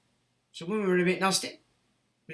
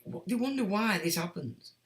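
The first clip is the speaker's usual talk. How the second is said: ordinary talk, nothing marked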